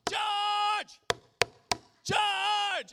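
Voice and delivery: put-on high, loud childish shout of George; piercing kid's call through a door; little girl yelling. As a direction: yell the name like an impatient kid at the door. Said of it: "her knock sounded a lot like this".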